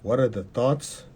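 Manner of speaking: ordinary talk, nothing marked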